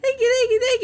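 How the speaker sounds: high, squealing thanks; overjoyed squeal. Real, not acted. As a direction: gush with thanks, near tears. Emotional thanks for a donation.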